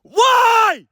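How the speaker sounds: very high, full-force yell; screaming for echo. Real, not acted. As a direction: holler one long word for the echo. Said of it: "whatever the fuck I was screaming".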